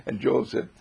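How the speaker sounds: ordinary delivery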